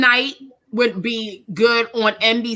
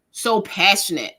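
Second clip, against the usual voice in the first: ordinary talk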